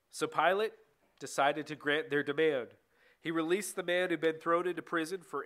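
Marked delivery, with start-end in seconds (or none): none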